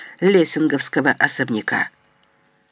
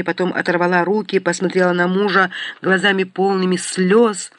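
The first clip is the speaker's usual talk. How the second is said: ordinary delivery, nothing marked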